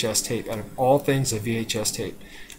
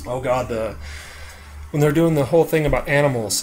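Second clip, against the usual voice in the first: ordinary talk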